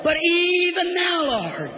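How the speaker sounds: soaring pulpit refrain; preacher's faith cry. Acted. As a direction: stretch it high, then fall away. Same refrain.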